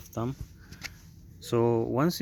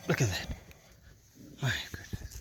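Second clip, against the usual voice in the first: breathy whisper under strain; 6 dB quieter than usual. Real, not acted. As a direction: whisper it while straining hard. Straining to spin stuck wheel.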